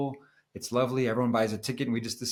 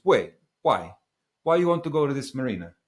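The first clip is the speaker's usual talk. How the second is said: put-on louder, puzzled Italian accent; 4 semitones higher, 6 dB louder than usual. Skipper questioning the planned marina stop, imitated Italian accent. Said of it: "stop with the Italian accent".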